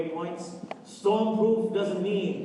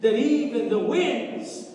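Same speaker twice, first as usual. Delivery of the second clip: rising, louder preacher's cadence, half-sung; preaching at full force; 4 semitones higher, 4 dB louder than usual. Acted. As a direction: preach it out, building to a whoop. Preaching climax of sermon.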